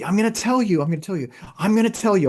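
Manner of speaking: high, loud, quickening promise; excited vow. Real, not acted. Talking to Gary on his phone, a high, loud, excited vow. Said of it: "I was speaking to the phone".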